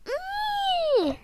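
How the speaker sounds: very high, loud sustained "eee"; high squeal. Shown as a demonstration. Showing what sick people can't do, piercing squeal. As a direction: squeal it out on one held high note. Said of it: "sick people can't go".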